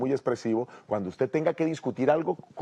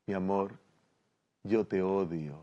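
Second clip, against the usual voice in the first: much softer, affectionate despite the words; tender, loving tone; 5 semitones lower, 7 dB quieter than usual. Acted. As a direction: say "te odio" like a caress. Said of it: "le está diciendo "te amo". (suave y cariñosa aunque diga "te odio")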